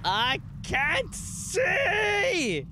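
high, very slow crying shout; drawn-out, tearful-sounding panicked cry; panicked wail. Real, not acted. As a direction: stretch every word into a high wail. Panics in dark horror game.

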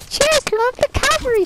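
Mercy voice. Shown as a demonstration. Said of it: "This is the Mercy voice!".

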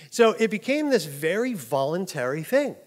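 normal talking voice

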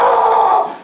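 very high, long comic wail; retold freak-out shriek; freaked-out scream. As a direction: cry out in mock panic. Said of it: "Just freaking out".

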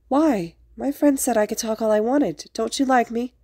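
high-pitched mock-hurt child; dramatic sniffling. A narrator's reading. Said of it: "Izuku sniffled dramatically".

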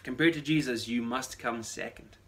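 ordinary talk, nothing marked

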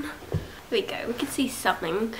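soft unvoiced whisper. Real, not acted. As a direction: breathe the words out quietly. Whispering while adjusting camera.

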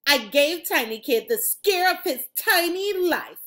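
plain reading voice

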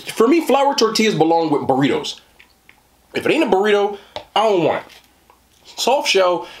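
ordinary talk, nothing marked

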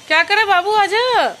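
loud high coaxing call. Real, not acted. Mother coaxing little Keshav to come back, loud high call. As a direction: coax him back from a distance.

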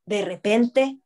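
ordinary delivery

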